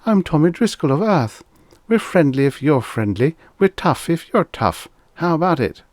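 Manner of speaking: put-on high boyish voice, eager and brave; a kid quoting his comic book hero; high piping boy playing space captain; excited small boy. A narrator's reading. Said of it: "which shook uncontrollably with his excitement".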